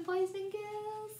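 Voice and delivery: ordinary delivery, nothing marked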